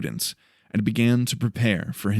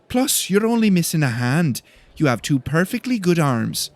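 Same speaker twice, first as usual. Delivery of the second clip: put-on indignant girl voice; 6 semitones higher than usual. A narrator's reading. Cynthia storming off.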